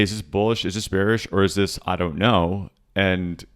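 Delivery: usual speaking voice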